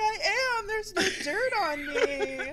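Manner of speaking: voicing the dog. Owner voicing her clean dog's proud reply, high squeaky. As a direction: play the dog as a smug little creature. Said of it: "he's like".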